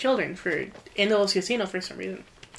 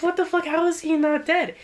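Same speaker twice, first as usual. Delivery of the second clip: higher-pitched retold disbelief; incredulous reaction; 7 semitones higher than usual. Recapper voicing a character who learns Luke survived, incredulous. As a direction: act out his baffled outburst.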